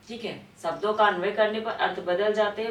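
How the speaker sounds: usual speaking voice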